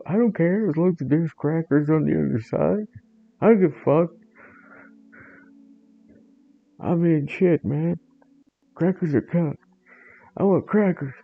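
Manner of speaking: high, loud simple-minded cat; squeaky food-obsessed dimwit begging; dopey cat Jeff. Acted. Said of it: "Jeff voice continues".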